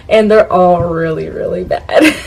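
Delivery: normal talking voice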